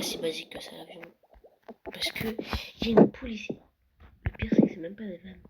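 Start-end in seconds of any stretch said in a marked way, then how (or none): 1.8-3.6 excited. Real, not acted. Spots a chicken in the plane.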